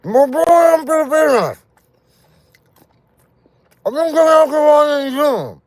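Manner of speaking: Tagalog cried out very high and loud; Tagalog in nasal voice. Acted. Trying the nasal voice in his own language, Tagalog, very high and loud. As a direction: shout the Tagalog through the nose, way up high. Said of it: "in my language, Tagalog".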